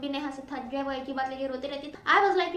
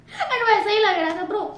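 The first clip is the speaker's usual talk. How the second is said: loud, higher, slow laughing exclamation; amused outburst; 5 semitones higher, 8 dB louder, slower than usual. Real, not acted. Telling viewers it really looked like that, loud and amused. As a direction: let the disbelief burst out.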